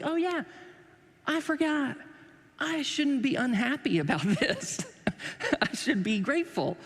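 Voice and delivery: high-pitched dawning realization; children's sudden realization. Retold or imitated. Children suddenly remembering to be grateful, mock realization.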